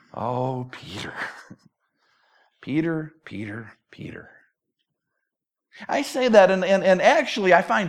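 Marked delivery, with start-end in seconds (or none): 0.1-4.3 soft, low, head-shaking lament; hushed dismay. Real, not acted. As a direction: murmur the name in mild exasperation. Sighing fondly over stubborn Peter.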